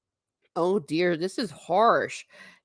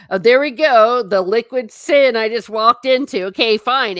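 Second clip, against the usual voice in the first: mock exasperated; 4 semitones higher, 9 dB louder than usual. Real, not acted. Chat judging her tea.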